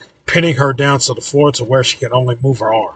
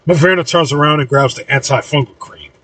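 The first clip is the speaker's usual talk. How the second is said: ordinary delivery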